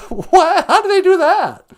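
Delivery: a retold loud gasp at the Trump fireworks; amazed. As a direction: gasp it out like a wowed kid. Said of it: "And I did go".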